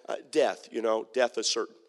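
ordinary delivery

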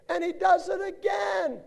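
high shouted disbelief; exasperated shout. Acted. Preacher shouting that the man did it again. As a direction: yell it in fed-up amazement.

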